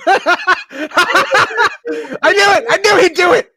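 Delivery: high, loud laughing shout. Real, not acted. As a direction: crack up while shouting. GM laughs at player's joke.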